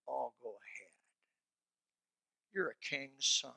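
low, hushed coaxing voice; sneaky snake of a friend; sneaky tempter. Retold or imitated. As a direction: coax him softly like a sly friend. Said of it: "a sneaky snake".

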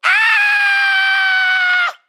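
put-on piercing high shriek of a kid; mock toddler scream on the plane; imitated kid scream. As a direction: let out a bratty child's scream. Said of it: "Then when he goes".

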